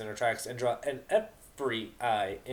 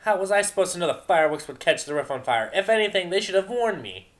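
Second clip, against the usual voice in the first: loud, high indignant outburst; shouted excuse; outraged protest; 8 semitones higher, 9 dB louder than usual. Acted. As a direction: defend yourself hotly. Vinyl protests loudly.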